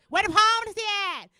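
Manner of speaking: high, loud imitation of a demanding woman; mock loud lady. Detroit lady demanding directions from the comic. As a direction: bellow it like an impatient stranger. Lady voiced shouting question.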